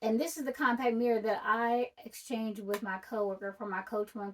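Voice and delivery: usual speaking voice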